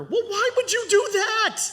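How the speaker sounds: put-on boy doubting the stranger's advice; indignant boy voice. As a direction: squeak out the objection like a child.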